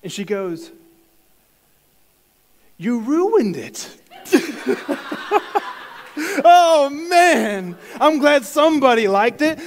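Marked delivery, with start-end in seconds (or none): 2.8-3.9 put-on blunt child verdict. Child's verdict on his haircut. 3.9-6.4 high, loud burst of laughter; big laugh. Real, not acted. Laughing at the girl's verdict, high loud laugh. Reacting to child's insult. 6.5-9.6 higher and louder, still laughing through the words. Real, not acted. Telling viewers about the haircut, laughing loudly.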